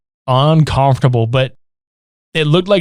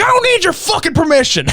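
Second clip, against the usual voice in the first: gruff snap; 12 semitones higher than usual. Retold or imitated. Acted quarrel quote.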